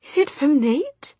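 put-on high, slow, hopeful girl's voice; fragile young woman. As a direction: ask with desperate hope.

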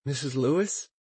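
sweet, sociable phone greeting; pleasantly social voice. Acted. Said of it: "in a pleasantly social voice".

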